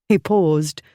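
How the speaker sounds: usual speaking voice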